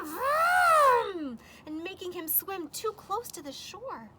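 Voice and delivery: ordinary delivery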